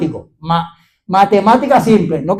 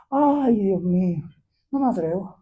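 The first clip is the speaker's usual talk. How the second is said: mock timid reluctance; 4 semitones lower, 11 dB quieter than usual. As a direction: play the believer who doesn't dare. Mimics believer afraid to serve.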